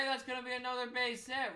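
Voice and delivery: usual speaking voice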